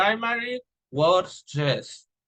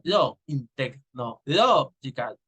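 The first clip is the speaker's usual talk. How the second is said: "technological" slowed, LO punched out; primary stress demo; 3 semitones lower, slower than usual. Shown as a demonstration. Teacher showing where primary stress falls, slow emphatic LO. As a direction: break up "technological" and lean on "lo". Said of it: "already have primary stress in".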